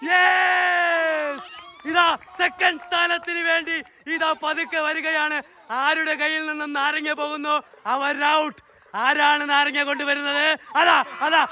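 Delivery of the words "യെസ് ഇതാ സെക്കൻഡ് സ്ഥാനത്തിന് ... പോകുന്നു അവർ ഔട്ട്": lively play-by-play, pitched higher; sports commentary. Acted. Commentating the kids' lemon-and-spoon race, lively play-by-play.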